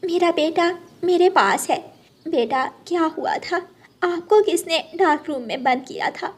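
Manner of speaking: a relieved mother bird questioning her little son. Acted. Rano the mother bird asks her son what happened, relieved mother voice.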